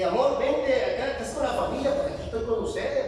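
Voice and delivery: a hint of a wife calling to her husband. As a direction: call him to the table like his wife. Wife calling him to table.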